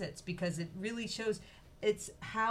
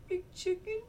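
high, small worried call; lost chick calling out; 13 semitones higher than usual. Acted. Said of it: "he gets a little worried".

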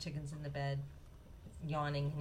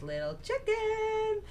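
high, long drawn-out call; Mama calling; 4 dB louder, much slower than usual. A narrator's reading. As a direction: call her chick from across the shop. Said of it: "And she says".

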